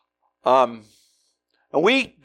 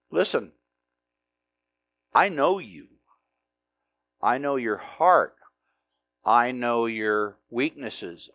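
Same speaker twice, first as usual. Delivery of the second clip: tender voice of God; 5 semitones lower, slower than usual. Acted. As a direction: speak each line slowly like God who sees through you. God speaking to the listener.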